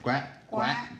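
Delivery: Donald Duck quack. Acted. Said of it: "quack like Donald Duck".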